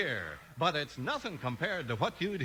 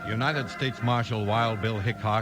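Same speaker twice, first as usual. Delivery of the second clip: low, steady dramatic narrator; radio drama narration; story narration; 5 semitones lower than usual. A narrator's reading. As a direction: set the scene like an old Western serial. Narrator sets the scene.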